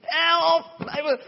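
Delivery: high pleading call for help; retold cry for help. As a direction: beg loudly over the crowd. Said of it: "begint een partij te schreeuwen". (blinde man roept om hulp)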